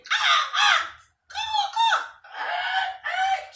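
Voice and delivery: high squawking caws like a bird; bird noises. Retold or imitated. Neighbor lady greeting trick-or-treaters with high bird caws. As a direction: caw it out high like a crow. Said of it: "her bird noises".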